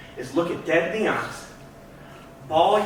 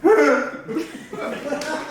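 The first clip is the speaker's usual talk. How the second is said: real laugh; 8 semitones higher than usual. Real, not acted. Laughs at own story.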